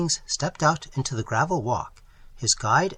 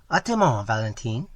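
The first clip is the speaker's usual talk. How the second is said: calling back. A narrator's reading. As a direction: call it back as you walk off. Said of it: "calling back".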